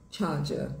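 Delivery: British "charger" without Rs, ending in "ah"; sotaque britânico. Shown as a demonstration. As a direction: drop the Rs in "charger", British style. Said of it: "muda de charger para charger". (sotaque britânico, sem R)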